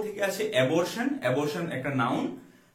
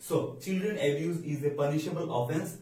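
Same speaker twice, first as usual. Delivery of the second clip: slow dictation; much slower than usual. Shown as a demonstration. Teacher repeats example slowly.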